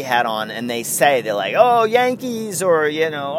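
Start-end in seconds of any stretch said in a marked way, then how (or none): 1.6-2.6 high, drawn-out friendly exclamation; imitated stoked fan. Passerby spotting a Yankee hat, mock excitement. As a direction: mimic a fan happy to spot the hat. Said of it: "all stoked on it".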